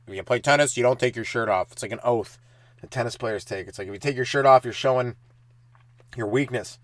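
usual speaking voice

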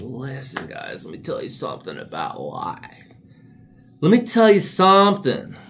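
a wise guru building to a yell; go-to sign-off voice. Pretend. Mock guru building from a hush to a shout at the viewers. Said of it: "that's my go-to sign".

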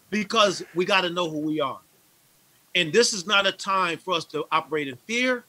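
normal talking voice

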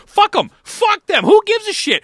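high, loud furious rant; angry yelling. Real, not acted. Fan ranting at rival team, angry yelling. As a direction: yell it out in disgust.

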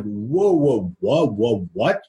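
mildly stunned, voice swooping up on each whoa; mock disbelief, halting someone mid-insult; taken aback. Acted shock at uncle's jibe.

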